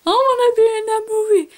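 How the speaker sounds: high, loud, excited squeal; eager wish. Retold or imitated. Gushing to viewers about the book's movie, high excited squeal. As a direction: gush about wanting a movie part. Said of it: "and I'm like".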